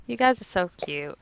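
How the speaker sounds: normal talking voice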